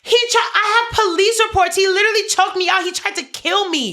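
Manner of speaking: loud, high, furious outburst; outraged protest. Real, not acted. Daughter protesting mom dismissing her abusive ex, furious. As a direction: shout it as undeniable proof.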